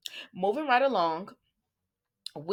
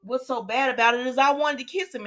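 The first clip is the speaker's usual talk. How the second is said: higher, whiny half-crying confession; mock tearful confession; 6 semitones higher than usual. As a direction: play the upset friend, voice cracking. Olivia confessing, upset.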